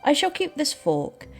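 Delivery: usual speaking voice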